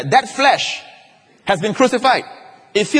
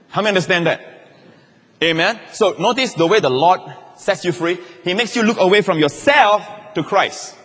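ordinary talk, nothing marked

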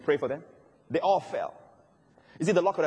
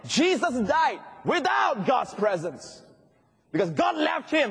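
preaching shout; 9 semitones higher, slower than usual. Acted. Preacher hits the key point.

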